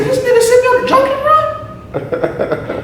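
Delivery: very high, drawn-out comic disbelief; mock astonishment. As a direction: squeal the realisation, on the edge of laughter. Retold realisation about junkie run.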